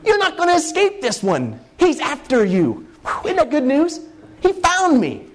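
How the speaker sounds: giddy high-pitched excitement; thrilled. Real, not acted. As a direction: let the delight burst out. Preacher thrilled, God's pursuit.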